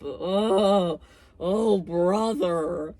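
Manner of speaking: loud, drawn-out groaned dismissal; exasperated groan. Real, not acted. Reviewer reacting to a pretentious heroine, exasperated groan. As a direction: stretch the words into a fed-up groan.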